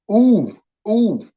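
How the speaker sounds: achy morning groan, high; stiff groaning ooh. Retold or imitated. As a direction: groan like getting out of bed stiff. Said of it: "that's the same noise I make when I get up".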